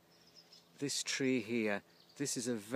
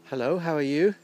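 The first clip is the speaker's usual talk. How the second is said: calling out to a calf; 3 semitones higher, 10 dB louder, faster than usual. Real, not acted.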